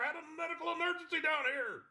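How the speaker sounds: high deadpan horse puppet. Acted. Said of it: "his voice is really close to my normal voice".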